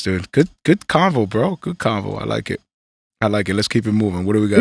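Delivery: muffled mic sound. Real, not acted. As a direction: talk like through a covered mic. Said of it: "It sounds, like, muffled".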